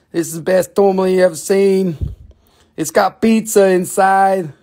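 hammed-up New York street-seller voice; New York accent. Seller pitches a tourmaline with a face, New York accent. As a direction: pitch the stone in put-on New York speak. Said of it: "going up to the New York accent".

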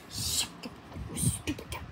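unvoiced whisper, very soft. Real, not acted. Hiding from the monster, urgent whisper. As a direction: hiss it so nothing hears.

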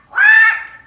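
loud quack. Acted. Duck calls out beside the brook, loud high quack. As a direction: call out like a startled duck. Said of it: "Duck lets out a quack".

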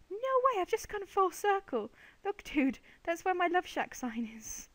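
loud, high-pitched astonished outburst; amazed disbelief. Real, not acted. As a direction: burst out in disbelief. Finds she has walked in circle.

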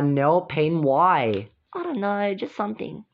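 normal talking voice

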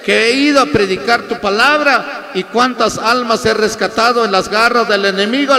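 impassioned retold prayer to the Lord; retold plea to God. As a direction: plead upward in a high, loud voice, easing slightly. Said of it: "solo dije".

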